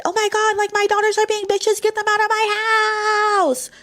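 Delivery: high, whiny mock of her mother, slowing at the end; imitating her mom griping about her daughters; mother complaining on the phone. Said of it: "after my mom called her and said".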